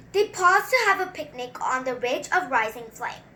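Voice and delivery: measured, rhythmic read-aloud; a kid reading a picture book to viewers; storybook reading. Acted. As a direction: read it like a bedtime rhyme. Reads the book text.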